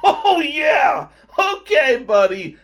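loud, high sarcastic outburst; mock indignation. Comic snapping back at friend about his aunt, mock indignation. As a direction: shout back in exaggerated protest. Acted retort to mocking friend.

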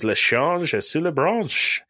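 mock French. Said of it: "he goes".

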